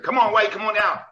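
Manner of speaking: high, loud cheering urge; little cheerleader. Retold or imitated. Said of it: "He cut him like a little cheerleader".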